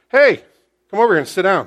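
high, loud Hey, then firm order; commanding call. Acted. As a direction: call him over with authority. Powerful Boaz calls someone over.

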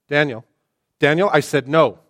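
put-on losing patience with the child; escalating parent scolding. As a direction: parent yelling no at a kid.

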